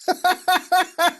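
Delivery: loud, high burst of laughter; real uncontrolled laugh; loud cackle. Real, not acted. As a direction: crack up loudly, high-pitched. Cracking up at next image.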